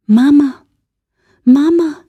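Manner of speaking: put-on high, loud child's cry of mama; a ghostly baby calling out; eerie infant voice calling; tiny voice calling. A narrator's reading. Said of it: "whispered a tiny voice".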